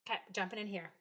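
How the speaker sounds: normal talking voice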